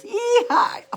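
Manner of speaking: high whooping cowboy holler; playful cowboy yell; cowboy yeehaw. Pretend. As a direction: whoop it like a rodeo rider. Mexican guy on rocking horse.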